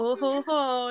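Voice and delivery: a phony Frenchman's smug laugh; fake French accent. The phony designer laughs at his own joke, in a hammed-up fake French accent. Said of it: "a fake French accent every now and then".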